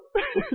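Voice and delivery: short high burst of laughter. Real, not acted. Preacher laughing at the youth minister's dread, short high laugh. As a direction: laugh at the memory. Laughing at his own story.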